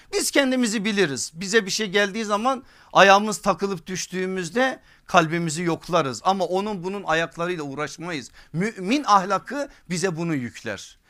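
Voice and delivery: ordinary talk, nothing marked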